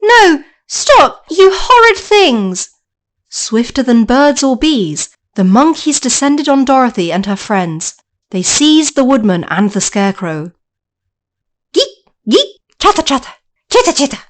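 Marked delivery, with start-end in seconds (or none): 0.0-2.7 put-on high, loud girlish protest; Dorothy shrieking at the monkeys, high and loud; frightened girl. 11.7-14.2 high squeaky monkey chatter; flying monkeys chattering as they swoop down. Acted.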